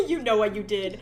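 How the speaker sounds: laughing while teasing. Real, not acted. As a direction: chuckle the accusation. Laughing repeat.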